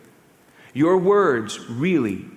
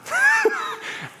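burst of laughter. Real, not acted. Laughs at own confession.

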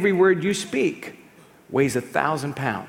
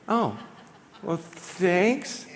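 awkward thanks; 3 semitones higher, 5 dB quieter, much slower than usual. Retold or imitated.

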